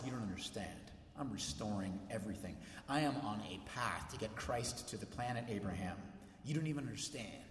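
low, soft reassuring voice; gentle voice of God. Pretend. God reeling doubters back with soft reassurance. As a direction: draw them back in, softly.

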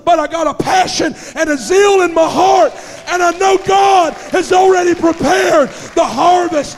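high, loud shouted sermon; a revival preacher at full cry; full-force preaching. Acted. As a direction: preach it out with fire and zeal.

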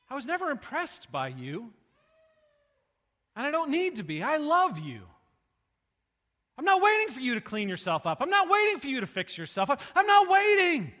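loud, climbing preacher's proclamation; preaching at full force. Acted. Preaching, voicing Jesus.

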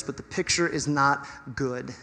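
normal talking voice

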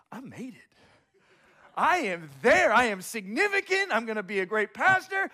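loud, high, gloating declaration; triumphant inner boast. Retold or imitated. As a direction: crow it like a champion, stretching the best. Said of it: "This is what I thought".

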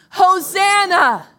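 Hosanna drawn out, loud and high; full shout. Shown as a demonstration. Pastor leading the congregation in a shout, long loud Hosanna. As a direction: shout it from the top of your lungs. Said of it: "Ready? One, two, three".

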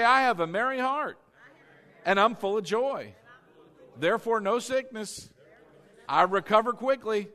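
high, loud faith declaration; emphatic call-and-repeat, phrase by phrase; declared confession. Shown as a demonstration. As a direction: proclaim it for a crowd to repeat. Leading congregation in declared confession.

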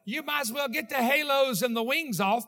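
loud preacher building to the climax; booming sermon delivery; full-force preaching. Acted. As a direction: drive it home to the congregation.

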